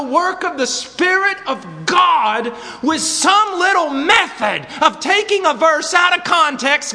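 loud, high, full-force sermon cry; impassioned preaching. Real, not acted. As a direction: thunder a slow, emphatic rebuke at the congregation.

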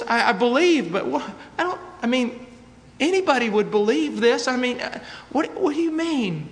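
high, stammering confusion; acted bewilderment. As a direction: protest haltingly, as a baffled seeker would. Acts out the man's confused objection.

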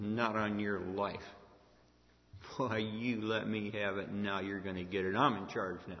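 low, deliberate mock threat; vengeful threat. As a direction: play the brother who now holds power. Imagined vengeful Joseph answering his brothers.

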